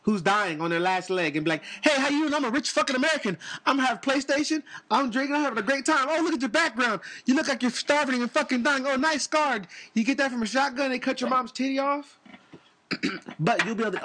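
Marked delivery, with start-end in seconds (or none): 1.8-12.2 obnoxious rich American. Retold or imitated. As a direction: brag like a careless rich tourist. Mocking rich American on video call.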